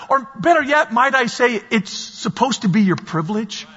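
normal talking voice

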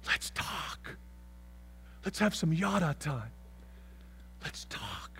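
soft, breathy whisper of thanks; God whispering. Acted. God whispering thanks to the confessor, tenderly. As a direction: hush it as if deeply touched. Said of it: "And God goes".

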